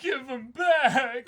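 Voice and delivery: high, loud, slow wail of a plea; desperate crying shout. Acted. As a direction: beg at the top of your lungs. Acted character crying out desperately.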